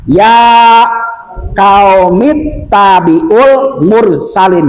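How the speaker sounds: slow, halting syllable-by-syllable reading with pauses; spelled-out Latin reading. Shown as a demonstration. Teacher shows how the Latin spelling reads, slow and piecemeal. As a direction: read it out piece by piece. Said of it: "kalau latennya gimana itu".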